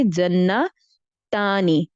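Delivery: جنتانی drawn out very slowly, syllable by syllable; slow model word. Shown as a demonstration. Teacher to her students, slowly giving the dual form. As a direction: stretch جنتانی so every sound is heard. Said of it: "تو اس کو کیا کریں گے".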